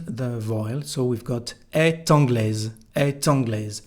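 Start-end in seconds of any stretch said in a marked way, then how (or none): 1.7-2.7 "est anglaise" linked with a sounded T; T liaison. Shown as a demonstration. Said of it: "a liaison between the T and". 3.0-3.8 "est anglaise" linked with a sounded T; T liaison. Shown as a demonstration. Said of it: "a liaison between the T and".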